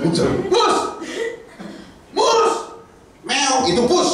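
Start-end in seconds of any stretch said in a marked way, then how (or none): 0.1-2.5 calling out. Acted. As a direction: yell the nickname. Calling Moses by name.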